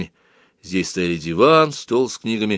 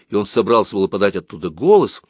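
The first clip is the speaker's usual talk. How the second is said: ordinary delivery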